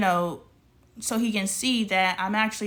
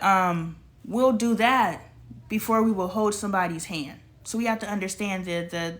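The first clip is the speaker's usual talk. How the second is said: ordinary talk, nothing marked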